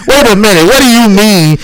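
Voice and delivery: confused outburst. Retold or imitated. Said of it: "I came over here like".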